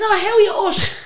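high, loud, laughing mock hello; gushing greeting. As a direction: greet a star with squealed enthusiasm. Retold greeting once she knows it's [name].

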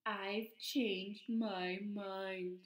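very slow, deliberate retraction; slow reconsidering. Acted. Pigeon backing out of wanting a puppy, slow retraction. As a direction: draw out every word. Pigeon backs out after the scare.

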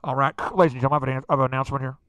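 loud, low mock announcer threat; club mic announcement. Angry guy on the club microphone, loud mock announcement. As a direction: boom it over the club PA. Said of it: "get on that microphone at the club".

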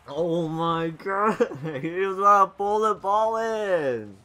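high, loud, slow laughing exclaim; laughing disbelief. Real, not acted. Gamer laughing at an opponent's play.

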